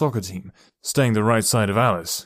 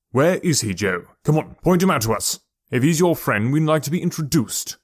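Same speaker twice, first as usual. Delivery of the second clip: louder, taunting father; mocking challenge; 4 semitones higher, 3 dB louder than usual. Father sarcastically demands to meet the invisible friend, taunting. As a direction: goad the boy to point him out.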